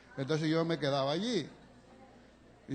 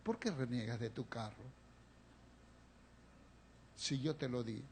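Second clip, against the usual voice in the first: much lower and much softer, grave and hushed; a deep quiet divine rebuke; low solemn voice of God, barely above a murmur; voice of the Lord; 7 semitones lower, 12 dB quieter than usual. Retold or imitated. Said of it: "el Señor me dijo".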